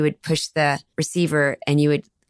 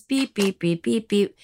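higher, short phone-button beeps; imitated button beeps; 4 semitones higher, slower than usual. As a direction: beep like a push-button phone. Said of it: "Or there were buttons".

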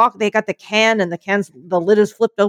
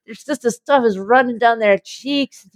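normal talking voice

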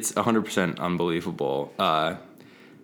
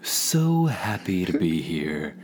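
radio voice; slower than usual. Acted. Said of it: "Such a smooth radio voice".

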